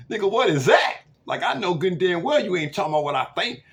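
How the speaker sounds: loud, high mock indignation; hammed-up outburst of disbelief; outraged grandma. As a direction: imitate an incensed, disbelieving grandmother. Grandma's outraged reaction, acted.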